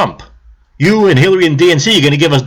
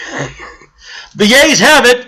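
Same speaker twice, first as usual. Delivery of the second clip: hammed-up high, loud ruling that the yays win; mock chair call; 9 semitones higher, 3 dB louder than usual. Chair mockingly declaring the yays win. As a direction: mimic the chair ruling for Hillary's side. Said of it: "the Hillary supporters".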